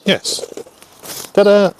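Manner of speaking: high triumphant ta-da. Real, not acted. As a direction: celebrate the fit with a flourish. Model finally fits.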